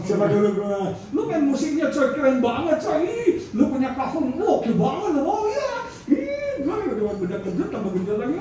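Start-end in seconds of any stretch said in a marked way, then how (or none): 1.1-5.2 high, gushing flattery of a sycophant; flattering gushing voice. Acted. Sycophant fawning over the proud preacher, high gushing. 5.3-8.4 high, giddy, fast self-satisfied squeal; preening proud man. Acted. Proud man soaking up the flattery, giddy high squeal.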